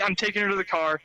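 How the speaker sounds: normal talking voice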